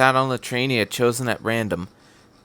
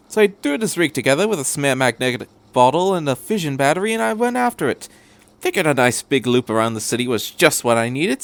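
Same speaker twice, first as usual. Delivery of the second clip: rambling, higher eccentric stranger; chatty Doctor; 4 semitones higher than usual. Acted. The Doctor rambling to Shinji about the tape, chatty. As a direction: babble happily about gadgets.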